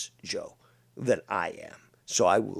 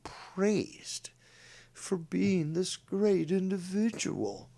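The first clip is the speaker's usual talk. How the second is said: sarcastic; 8 semitones higher, 4 dB quieter, much slower than usual. Acted. Mocking praise.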